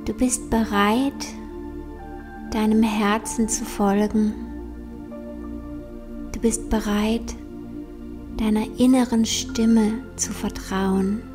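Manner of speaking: slow, soft meditation voice; guided meditation. Acted. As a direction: guide them gently into calm, slowly.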